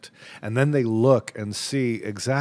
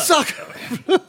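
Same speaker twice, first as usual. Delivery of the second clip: high mock French cry; French accent; 3 dB louder than usual. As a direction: squeal it like a cartoon Frenchman. Echoing French exclamation joke.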